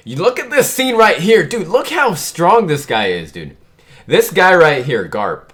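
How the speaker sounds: loud, high, hyped-up reaction; real amazed excitement. Real, not acted. Manga reader marveling at Garp's strength, loudly excited. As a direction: gush loudly over an astonishing moment.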